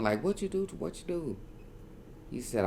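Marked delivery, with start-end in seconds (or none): 0.2-1.4 imitated girl screaming. Dazed victim hears the girl screaming, imitated scream. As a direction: pitch it right up, mimicking a screaming girl. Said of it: "I hear the girl screaming".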